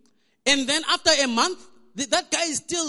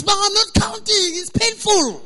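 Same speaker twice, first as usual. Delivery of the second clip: raised, louder protest of a labouring wife; pained wife; 5 semitones higher, 4 dB louder than usual. Retold or imitated. Wife in labour refuses husband's counting, pained protest. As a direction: imitate a wife snapping through labour pain. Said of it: "she's in pain".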